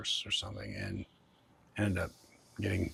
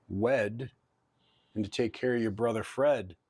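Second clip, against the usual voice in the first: addressing a spirit; 3 semitones higher, 5 dB louder than usual. Real, not acted. Addressing a ghost named Mert.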